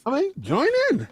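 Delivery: laughing pitchman urging people in; commercial voice. Acted. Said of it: "commercial voice".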